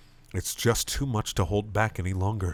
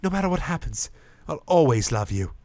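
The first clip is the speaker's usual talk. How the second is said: tender vow; 7 semitones higher, 3 dB louder than usual. Acted.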